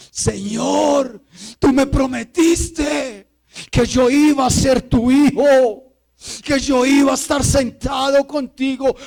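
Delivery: loud, high, drawn-out pleading; impassioned crying out to God. Acted. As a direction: shout a desperate prayer up to heaven. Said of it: "Así vive el hombre espiritual".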